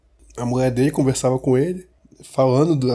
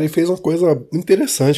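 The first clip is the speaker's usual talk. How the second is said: normal talking voice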